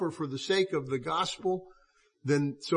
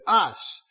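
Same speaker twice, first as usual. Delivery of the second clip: "us" repeated, hit a little louder; stress on us; 4 dB louder than usual. Shown as a demonstration. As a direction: lean on "us" to stress it's all of us. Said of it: "He said us. He didn't say me".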